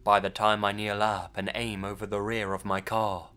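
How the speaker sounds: ordinary delivery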